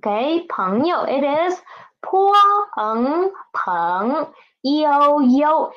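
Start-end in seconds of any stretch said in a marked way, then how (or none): 2.0-5.7 泼朋朋 slowly spelled, then 友友 light; sounding out slowly. Shown as a demonstration. Teacher spelling out 朋友 for the class, slowly. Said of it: "yo is neutral tone".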